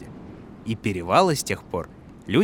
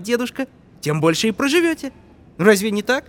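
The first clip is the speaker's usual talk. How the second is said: put-on persuasive boy voice; 8 semitones higher than usual. Boy persuading old man.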